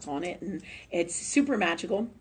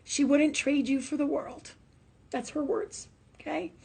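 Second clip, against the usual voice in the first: getting emotional; 6 semitones higher than usual. Real, not acted. Relaying a mother's words, choked up and emotional. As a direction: hold back tears as you pass it on. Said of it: "I'm getting all emotional".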